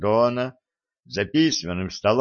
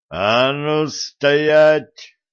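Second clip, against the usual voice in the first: slow, loud, drawn-out order; commanding shout; 5 semitones higher, 5 dB louder, much slower than usual. A narrator's reading. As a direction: stop him dead with your voice. Koschei commands fleeing tsar.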